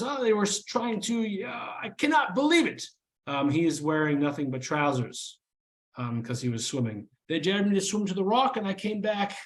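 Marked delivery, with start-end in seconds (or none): 0.0-2.8 high-pitched, earnest elf. Acted. Sebastian explaining himself to the party, high voiced. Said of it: "he says". 7.3-9.4 high-pitched, earnest elf. Acted. Sebastian explaining himself to the party, high voiced.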